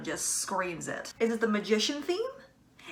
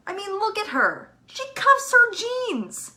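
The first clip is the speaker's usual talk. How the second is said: high exclamation rising to a loud, slow emphasis; excited insistence; 11 semitones higher, 7 dB louder than usual. Real, not acted. Fan proving Marinette is bisexual, excited insistence. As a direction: push each word higher and louder as obvious proof.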